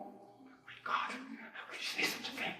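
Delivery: sarcastic horror in a hushed whisper; mock-shocked. The priest mocking critics of the speech in a sarcastic, hushed gasp of horror. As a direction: pretend to be scandalized, barely breathing it.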